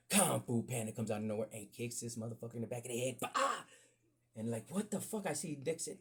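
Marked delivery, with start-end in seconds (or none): 3.2-3.6 high loud bah; mock kick noise. Kung Fu Panda kicks the bully, loud bah. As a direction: make the kick sound.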